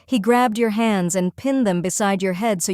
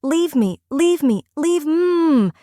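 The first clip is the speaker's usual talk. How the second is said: higher, pleading and desperate; frightened pleading; 7 semitones higher, slower than usual. Acted. Captive pleading with her captor to be left alone, frightened. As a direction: beg him to let you go.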